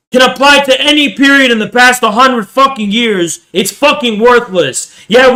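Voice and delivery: furious shouting lecture; angry rant. Real, not acted. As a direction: hammer the point home in real anger. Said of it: "I'm pissed now".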